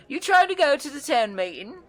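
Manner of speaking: very high, loud, bubbly woman's voice; excited red-haired woman. Acted. Wiry red-haired woman greets the adventurers, very high and thrilled. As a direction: bounce in thrilled to meet them. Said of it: "excitedly beside you and says".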